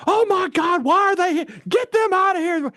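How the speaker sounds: very high-pitched mock panic; freaking-out mom. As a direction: imitate a frantic mother crying out orders. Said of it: "and she freaked out".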